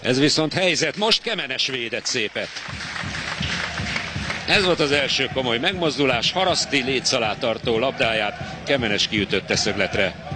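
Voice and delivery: normal talking voice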